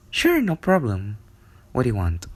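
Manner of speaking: plain reading voice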